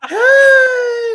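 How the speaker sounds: high, loud excited yell; shouting hey with delight. Retold or imitated. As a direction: run to him shouting with joy. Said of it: "And I'm like".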